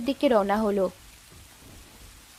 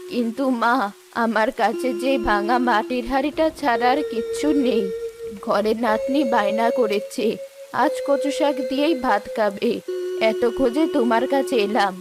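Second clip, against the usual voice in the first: put-on pleading old woman.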